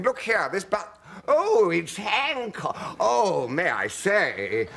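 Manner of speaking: high, loud, slow dawning delight; starstruck passengers. Retold or imitated. Passengers spotting Hancock, starstruck and loud. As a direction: realise it's Hancock and gush.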